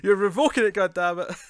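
mock fury. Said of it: "Go all in on it".

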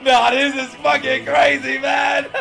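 loud, high yell; real hyped yell; disbelieving shout. Real, not acted. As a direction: shout it at the screen. Yelling in disbelief.

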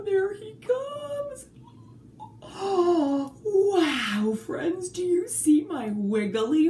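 high, eager exclaiming; excited. Acted. Teacher excitedly unveils a snake to children. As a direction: gasp and build it up with wonder.

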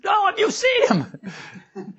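high, slow, desperate wail; frantic shout. Retold or imitated. Frantic mother asking her husband about their son, high shrieking wail. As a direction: shriek it like a mom losing her mind. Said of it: "He says".

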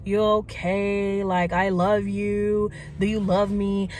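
slow, syrupy mock tenderness; slow mock lovey-dovey boyfriend. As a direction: draw it out like fake affection. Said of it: "try to be like".

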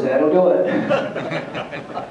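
very slow, laughing through the words; laughing drawl. Retold or imitated. Doctor reacting to the goonie bird story, slow laughing reply. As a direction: let the laugh stretch every word.

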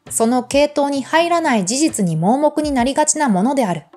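normal talking voice

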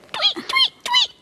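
tiny, very high chirps; bird tweeting. Acted. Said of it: "A bird says".

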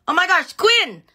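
high, loud happy greeting; excited shout-out. Real, not acted. Greeting a viewer who joined the live, excited high shout-out. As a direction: call out to a friend across the room. Excited about seeing friend.

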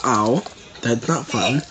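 normal talking voice

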